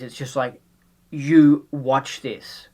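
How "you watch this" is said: slow insistence. Pretend. Imagined verdict on a great film.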